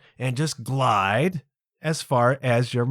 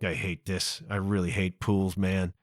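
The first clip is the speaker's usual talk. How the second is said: much softer, lower, muttering to himself; muttered grumble; 7 semitones lower, 9 dB quieter than usual. Retold or imitated. Dreading the pool, he mutters to himself under his breath.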